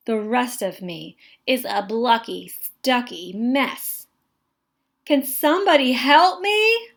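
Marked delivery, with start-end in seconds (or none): 5.1-6.9 louder, higher call for help. A narrator's reading. Stuck boy calling classmates for help, raised voice. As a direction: call across the room for help. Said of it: "I call".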